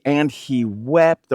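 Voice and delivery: slow, weighty "wept" with a lift at the end; slow emphasis. Shown as a demonstration. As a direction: lean into "wept" slowly. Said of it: "the literal translation ... would be".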